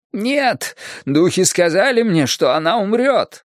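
put-on high, agitated old medium; agitated fortune-teller. A narrator's reading. Old fortune-teller contradicting her client, agitated and high. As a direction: insist in a high, excited voice.

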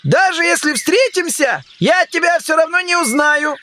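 high-pitched, peevish egg-man; displeased grumble. A narrator's reading. Humpty Dumpty answering Alice's farewell, displeased and high-pitched. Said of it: "недовольно проворчал Шалтай".

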